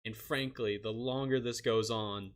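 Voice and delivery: usual speaking voice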